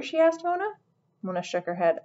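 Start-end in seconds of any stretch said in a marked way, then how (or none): none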